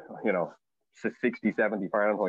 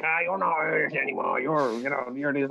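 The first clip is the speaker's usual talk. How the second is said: higher, mocking hometown voice; teasing locals; 6 semitones higher than usual. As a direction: rib the returning emigrant. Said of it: "I'm like".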